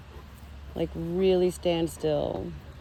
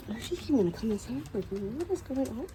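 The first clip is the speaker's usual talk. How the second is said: softer and quick, hushed and amazed; hushed amazement; 4 semitones higher, 5 dB quieter than usual. Real, not acted. Dog follows her inside, softer hushed amazement. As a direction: marvel under your breath.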